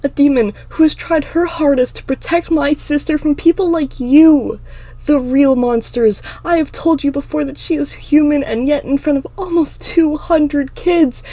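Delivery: high, loud, impassioned tirade; furious outburst. Acted. Emma accuses Clinton of attacking her sister, furious outburst. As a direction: build to an outraged yell. Emma's anger breaks out.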